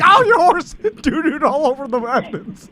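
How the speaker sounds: high voice breaking up with laughter; laughing. Acted. Detective complaining about a horse at the crime scene, laughing. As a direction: laugh through the acted line. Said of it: "I'm laughing because I imagine".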